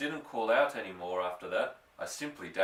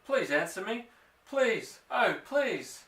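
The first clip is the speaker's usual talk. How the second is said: rising, desperate high begging; plead, voice climbing toward tears; trembly pleading; 7 semitones higher, 3 dB louder than usual. A narrator's reading. Lost boy begging his father to answer, trembly and rising. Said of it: "my voice began to go all trembly".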